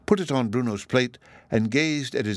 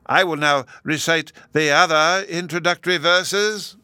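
high, louder professor voice; other professor, announcing; 8 semitones higher, 5 dB louder than usual. A narrator's reading. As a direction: announce a recital in a raised, high voice.

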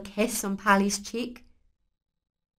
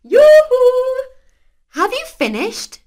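high, loud sing-song call; 14 dB louder, slower than usual. A narrator's reading. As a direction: call across the house. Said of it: "called Pilly".

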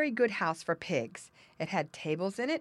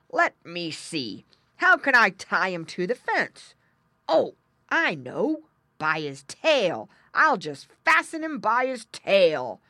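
loud, deliberate bear musing, often slow; pondering bear; 7 dB louder than usual. A narrator's reading. Bear works out tying the pig, pondering loudly. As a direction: puzzle it out loudly, then hit on the idea.

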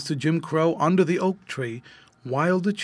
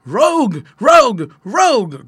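loud, forceful barked words; a storybook dog growling out each word loudly; 8 semitones higher, 10 dB louder than usual. A narrator's reading. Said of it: "growled Rover in his deep voice".

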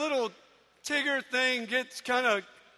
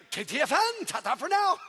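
Tigger bounce; 4 semitones higher, 3 dB louder than usual. Retold or imitated. Tigger signing off.

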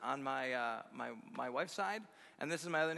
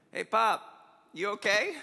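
loud mock call to an old father, pitched up; calling out to dad; 6 semitones higher, 9 dB louder than usual. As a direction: shout across the dock to check on Pop. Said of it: "and, and goes".

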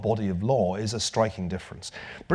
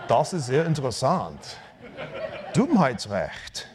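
mock German. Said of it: "It's like".

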